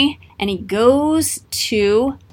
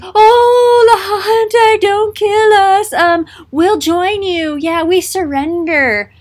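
much higher and louder, slow, whiny begging; mock pleading; 10 semitones higher, 6 dB louder, slower than usual. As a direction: fake a cowardly surrender. Amalickiah begs, pretending surrender.

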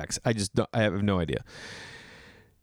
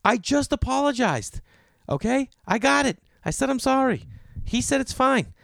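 exasperated; 14 semitones higher than usual. Retold or imitated. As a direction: lose patience with the scolding lady. Exasperated reply to scolding woman.

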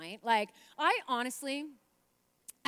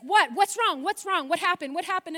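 a worried husband, louder and higher; alarmed husband; 7 semitones higher, 10 dB louder than usual. Retold or imitated. Husband rushing in, asking his wife what's wrong. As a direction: ask urgently what's wrong.

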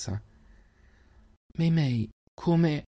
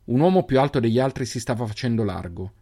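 ordinary delivery, nothing marked

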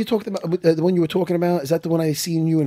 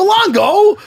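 mock incredulous; 14 semitones higher, 5 dB louder than usual. Retelling exchange with Longo.

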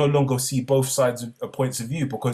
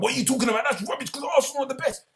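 much higher, fast, outraged fan; indignant fan; 13 semitones higher, much faster than usual. Retold or imitated. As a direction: scoff like a diehard fan. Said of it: "and I'm like".

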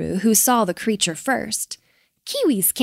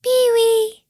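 high, ringing bird call; calling back; 14 semitones higher, 6 dB louder than usual. A narrator's reading. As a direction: answer a friend's call, high and loud. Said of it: "The other Kiwis called back".

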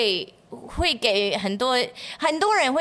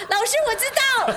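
bright eager hand-up pupil, much higher; eager know-it-all student; 11 semitones higher than usual. Retold or imitated. 学生向老师抢答，热切高音的模仿. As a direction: play the keen top student with a laugh. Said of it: "我就是很妙丽啊".

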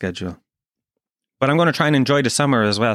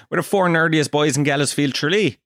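ordinary talk

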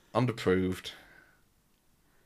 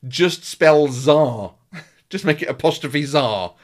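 shouting at the TV; 5 semitones higher, 8 dB louder than usual. Retold or imitated. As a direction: yell advice at a contestant on TV. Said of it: "shouts at the T V".

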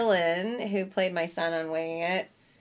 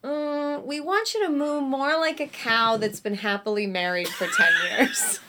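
higher-pitched imitation of a director; mock director; 7 semitones higher than usual. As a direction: give an absurd note as a mock director. Director's absurd note, acted out.

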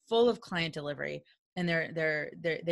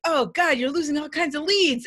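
louder and higher urgent warning; hammed-up oh God; mock alarm; 9 semitones higher, 7 dB louder than usual. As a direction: sound the alarm playfully. Speaker retells own warning.

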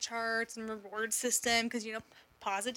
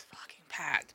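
whisper; 7 semitones lower, slower than usual. Real, not acted. Retelling the crowded restaurant.

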